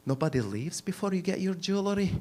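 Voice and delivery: put-on dead-serious Scottish accent, softly spoken; mock-intense Scottish accent; intense Scottish guy. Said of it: "they're all intense. And the Scottish guy is like".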